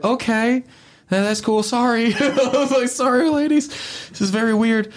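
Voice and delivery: flustered and squeaky, backing away politely; mock nervous high voice; awkward squeak. Awkward reply to strange man.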